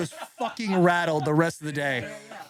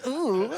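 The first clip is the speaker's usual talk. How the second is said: imitated "ooh"; 8 semitones higher than usual. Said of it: "he goes".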